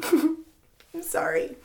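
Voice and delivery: slow, soft, choked-up apology; real tearful catch, drawn out softly. Real, not acted. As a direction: apologize while holding back tears. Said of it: "I cry all the time".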